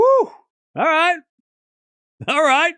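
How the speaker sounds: high, loud whoop of joy; excited cheer. Real, not acted. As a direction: cheer the point on. Cheering after winner.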